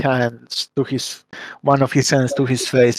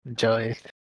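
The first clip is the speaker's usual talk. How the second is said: wry smirk; 2 semitones lower, 9 dB quieter than usual. Acted. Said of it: "a side smirk as he says".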